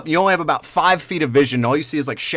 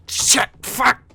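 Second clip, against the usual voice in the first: sudden much higher curse; startled curse; 12 semitones higher than usual. Acted. Adventurer snagged in window curses, high yelp. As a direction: yelp it as your armor snags. Armor catches during dive.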